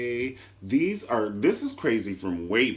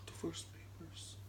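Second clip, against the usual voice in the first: breathless whisper of the words Divorce papers; 4 semitones higher, 22 dB quieter than usual. Shown as a demonstration. Host echoing the scandal, whispered.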